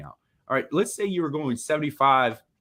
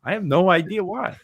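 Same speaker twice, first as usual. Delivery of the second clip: clueless act, pitched up; playing dumb; 5 semitones higher, 4 dB louder than usual. Shown as a demonstration. Lawyer demonstrating a clueless reply to an officer, playing dumb. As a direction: act as if you have no idea. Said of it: "Just play dumb".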